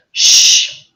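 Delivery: long drawn-out "Sh"; dragged sh. Shown as a demonstration. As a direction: stretch the "Sh" hiss. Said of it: "or you just drag it".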